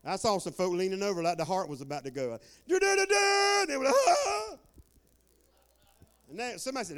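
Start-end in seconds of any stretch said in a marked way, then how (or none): 2.7-3.7 high, loud vocal fanfare, held long; imitated trumpet blast. Preacher retelling the balcony trumpet prank, blaring imitation. 4.1-4.6 high, loud mock fright; imitated startled gasp. Preacher imitating folk startled by the trumpet, mock-frightened cry.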